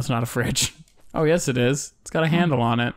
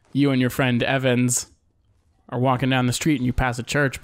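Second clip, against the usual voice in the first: ordinary talk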